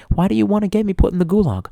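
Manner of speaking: ordinary delivery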